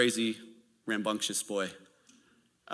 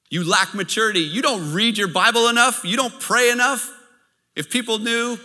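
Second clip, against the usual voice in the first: higher and louder, a jeering accuser; loud accusation; 8 semitones higher, 6 dB louder than usual. Retold or imitated. The enemy taunting a believer, loud and mocking. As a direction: pile on the charges, rising. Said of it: "lies that the enemy speaks to us".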